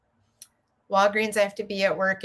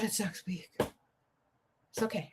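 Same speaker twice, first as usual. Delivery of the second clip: slow, much softer self-reassurance; calming herself; 13 dB quieter, much slower than usual. Real, not acted. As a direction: talk yourself down, very slowly. Calming herself about next week.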